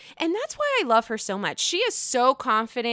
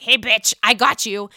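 louder mock Southern accent; 6 dB louder than usual. [name] trying to match Sutton, mock Southern accent. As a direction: imitate a Southern accent, punching it out. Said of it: "She's going all Southern accent with Sutton".

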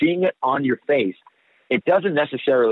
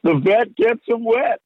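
high, slow, drawn-out movie impression; high-pitched character quote, played for laughs; Bill Paxton; 11 semitones higher, slower than usual. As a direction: stretch it out in a high put-on voice. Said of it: "wanted to do a Bill Pa".

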